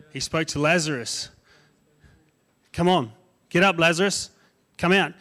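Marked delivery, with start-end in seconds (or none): 2.7-5.1 a raised, higher call to wake the sleeping; loud voice. Acted. Jesus calls dead Lazarus to come out, loud call. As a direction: call him out of the tomb. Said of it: "he said it in a loud voice".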